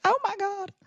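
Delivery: high squealing oh my God; excited squeal. Retold or imitated. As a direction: squeal it in delight. Mom voiced, very high.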